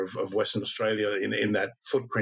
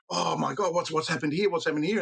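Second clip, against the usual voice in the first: higher-pitched mock fluster; retold alarm; 7 semitones higher than usual. Storyteller voicing everyone out on the lawn, in mock alarm. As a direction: act out bewildered neighbours, pitched up.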